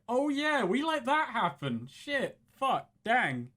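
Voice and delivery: high-pitched mock dismay; sudden, high realization; belated realization. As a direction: play it as if it just dawned on you. Acted belated realization of neglect.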